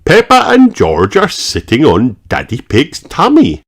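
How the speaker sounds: plain reading voice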